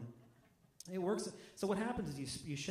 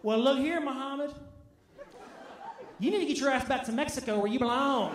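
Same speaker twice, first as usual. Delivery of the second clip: loud, higher mocking rant; 7 semitones higher, 7 dB louder than usual. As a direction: bellow it like a sneering bigot, fast at the end. Mocking a racist heckler.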